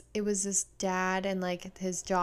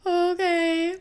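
put-on meek kid okay; 10 semitones higher, 8 dB louder than usual. Child answering mom.

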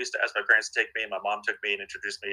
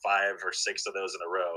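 ordinary delivery

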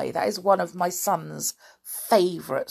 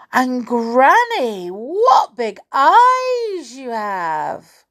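high, slow, loud wonder; wide-eyed storybook child, drawn-out; amazed little girl; 7 semitones higher, 8 dB louder, much slower than usual. Acted. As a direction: marvel with long stretched words. Girl marvels again.

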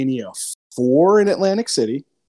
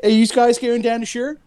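put-on higher Philly-accented voice; Philadelphia accent; 9 semitones higher than usual. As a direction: ask it like a Philly local. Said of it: "stuck to a Philly accent".

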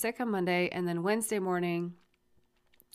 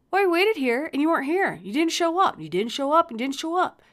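high, raised-voice imitation of an aggrieved kid; indignant accusation; 8 semitones higher, 6 dB louder than usual. Son blaming the parent for the missed pickup, indignant. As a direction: hammer the complaint, voice pitched up.

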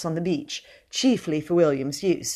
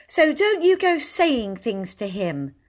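indignant; 7 semitones higher, slower than usual. Acted. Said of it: "she said indignantly".

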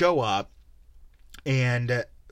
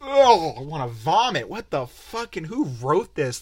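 much higher and louder, a hammed-up gag; exaggerated disgusted groan; mock disgust; 4 semitones higher, 6 dB louder, slower than usual. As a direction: recoil from the cheesy script. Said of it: "And I'm like".

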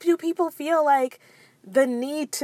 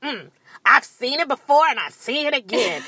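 lightly imitated disapproving official; a hint of a know-it-all scold; judgmental TSA lady. TSA lady doubting the mother, judgmental. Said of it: "And she's like".

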